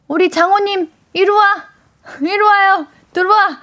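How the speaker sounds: cutesy come-here with laughs; cute calling. Acted. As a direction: coax the listener to come here with a giggle. Host calls listener in cutely.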